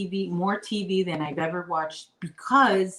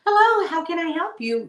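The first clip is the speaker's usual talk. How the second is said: higher mock call-centre greeting; insurance agent; 11 semitones higher, 5 dB louder than usual. Insurance agent picking up the call, higher mock greeting. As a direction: chirp the agent's hello. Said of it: "right when I started chasing him".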